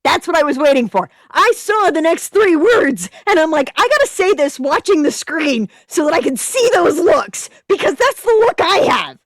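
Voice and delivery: loud, high excitement; excited. Real, not acted. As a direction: gush about it. Excited reaction to story.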